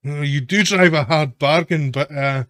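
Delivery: loud, high bargaining boss; cheery highwayman haggling at volume; amused gang boss. Acted. Toll boss answers the haggling.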